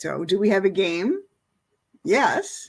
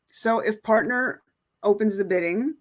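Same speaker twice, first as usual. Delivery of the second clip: usual speaking voice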